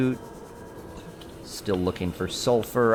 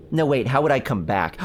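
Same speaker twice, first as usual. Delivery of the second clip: usual speaking voice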